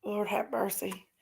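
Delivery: breathy whispered exclamation. Real, not acted. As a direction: whisper it to yourself, overwhelmed. Overwhelmed by the donation.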